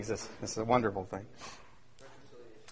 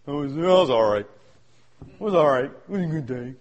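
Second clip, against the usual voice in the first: lower, unimpressed mumble of a bored churchgoer; bored shrug; 5 semitones lower than usual. Retold or imitated. Churchgoer mocking a dull service, lower and unimpressed. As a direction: play it down like nothing happened. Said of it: "Nobody come home going".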